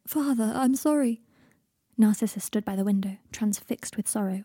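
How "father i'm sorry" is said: slow, higher delirious plea; babbling apology. A narrator's reading. Delirious Draco begs his dead father, babbling plaintively. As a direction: draw out a pleading apology. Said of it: "babbled Draco".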